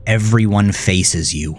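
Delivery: usual speaking voice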